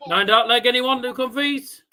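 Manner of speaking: ordinary talk, nothing marked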